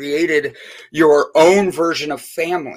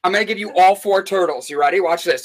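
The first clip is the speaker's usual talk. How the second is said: normal talking voice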